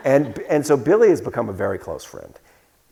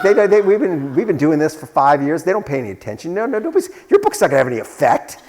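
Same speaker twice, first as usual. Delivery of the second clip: higher, louder mock exasperation; retold shouting; 6 semitones higher, 5 dB louder than usual. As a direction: imitate an agitated caller raising his voice. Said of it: "but he's shouting".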